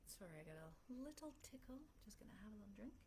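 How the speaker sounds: hushed, quick aside while drinking; quiet aside. Real, not acted. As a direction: speak softly away from the mic. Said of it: "You can probably hear me swallowing".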